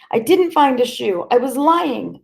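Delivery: put-on boy crying to the policeman holding his hair. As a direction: cry it out in fear. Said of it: "I cried".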